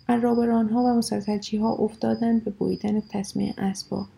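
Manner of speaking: ordinary delivery, nothing marked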